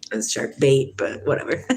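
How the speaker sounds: ordinary talk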